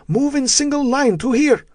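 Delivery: put-on high yelled order in a Russian accent; Russian captor yelling. A narrator's reading. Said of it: "the Russian yelled at them".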